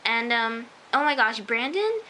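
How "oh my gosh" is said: ordinary talk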